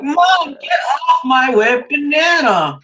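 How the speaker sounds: high, slow whiny shout at mom; mock whiny kid yell. Mock kid yelling at his mom to get off. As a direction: yell at mom like a bratty child.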